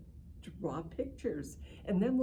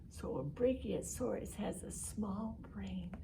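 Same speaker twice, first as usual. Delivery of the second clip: hushed whisper; 5 dB quieter than usual. Acted. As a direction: whisper it like a funny secret. Teacher on tiny dinosaur brain.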